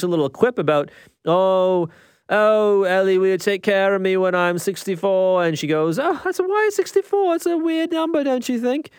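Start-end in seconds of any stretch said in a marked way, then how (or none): 1.3-5.4 a playful put-on British accent, a bit higher; quoting Jack in a British accent. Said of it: "I'm gonna do this accent a lot". 6.0-8.9 put-on much higher, girlish British accent for Ellie. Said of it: "I'm gonna do this accent a lot".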